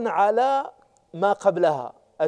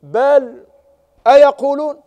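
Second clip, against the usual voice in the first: بل then أيقولون, higher and louder; stress on بل; 6 semitones higher, 7 dB louder, slower than usual. Shown as a demonstration. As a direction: punch بل, lift أيقولون. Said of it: "أم يقولون بل".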